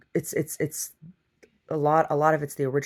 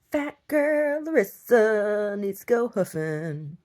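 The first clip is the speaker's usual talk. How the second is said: higher, slow and drawn out with a put-on voice; mock announcement; 7 semitones higher, much slower than usual. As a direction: stretch it out like a grand proclamation. Repeats her line as a performance.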